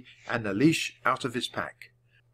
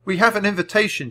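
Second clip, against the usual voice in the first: shouting; 8 semitones higher, 4 dB louder, faster than usual. A narrator's reading. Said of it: "Jack shouted".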